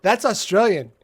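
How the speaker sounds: playful fake Australian; Australian accent. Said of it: "That's the only Australian accent that matters".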